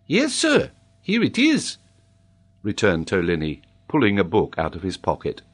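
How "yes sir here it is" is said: put-on very high child's voice; eager schoolboy. A narrator's reading. Boy shows the geographer his prize book, high eager voice. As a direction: chirp it like a proud pupil.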